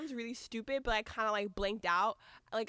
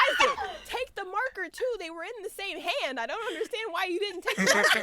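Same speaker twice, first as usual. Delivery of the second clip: high-pitched complaint; exasperated; 8 semitones higher, 4 dB louder than usual. Retold or imitated. Fan scolding guard over the marker, exasperated.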